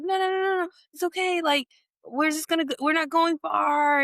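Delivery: very high, hurried brushing-off of worries; rapid high-pitched mock pleading; insistent reassurance, imitated. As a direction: act out a friend waving away objections. Imitated insistent reassurance about car.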